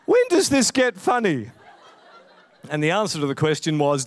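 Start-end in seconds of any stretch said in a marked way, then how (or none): 0.0-1.5 higher, laughing hint of an Irish accent; a light Irish accent, puzzled and complaining; a touch of Irish, bewildered at the film; little Irish voice. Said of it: "this little Irish voice pops up".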